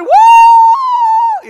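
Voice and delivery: high, loud coaster cheer; yelled woo. Retold or imitated. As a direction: whoop like you're loving the ride. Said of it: "So I start yelling".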